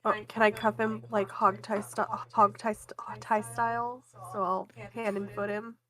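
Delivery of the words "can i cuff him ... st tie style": ordinary delivery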